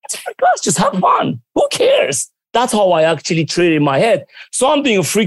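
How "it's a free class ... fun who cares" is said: much higher, breezy who-cares voice; squeaky carefree shrug; carefree mock voice. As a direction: toss it off like nothing matters. Mimicking a carefree attitude.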